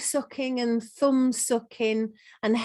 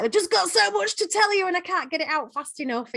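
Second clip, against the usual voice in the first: fast, high excited rush of words; excited gabbling; 9 semitones higher, faster than usual. Acted. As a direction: act out a child bursting to tell you something. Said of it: "It's like an excited thing".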